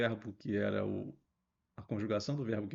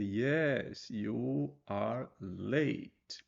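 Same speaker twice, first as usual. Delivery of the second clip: slow, drawn-out full answer; slow overlong answer; much slower than usual. Shown as a demonstration. Teacher shows the unneeded long answer slowly. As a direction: stretch out the long version. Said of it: "Você não precisa dizer".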